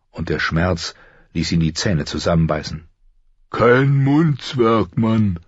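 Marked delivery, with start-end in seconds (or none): 3.5-5.4 slow, drawn-out jailer warning, slightly higher; lightly voiced menacing jailer. A narrator's reading. Jailer warns the prisoner to shut up, slow menace. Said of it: "warnte Mord".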